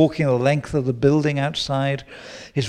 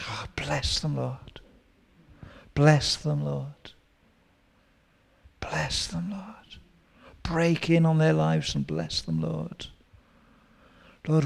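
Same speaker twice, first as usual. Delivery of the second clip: soft, whispered intercession; hushed prayer; 3 dB quieter than usual. Shown as a demonstration. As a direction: pray over them gently under your breath. Prays for the neighbours.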